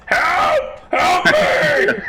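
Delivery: high, loud, panicked giant cry; wounded giant screaming to his guards for help; giant yelling for help. Acted. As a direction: shriek for help like a scared giant. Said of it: "yelling out to the guards".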